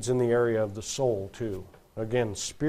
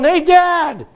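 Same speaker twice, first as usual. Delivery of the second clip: put-on high shouted call of a boy; 13 semitones higher, 10 dB louder than usual. Boy dangling from a tree shouts for his dad.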